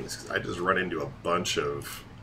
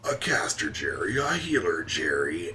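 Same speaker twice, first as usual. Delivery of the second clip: hammed-up mock promo voice; dungeon boss announcer. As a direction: sweep up and down on each name like a hype man. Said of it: "doing the dungeon boss announcer voice".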